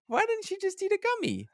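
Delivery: very high put-on lady voice; prim lady. Imitating the lady complaining to her husband, very high. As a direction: squeak it like a fussy lady. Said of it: "She's like".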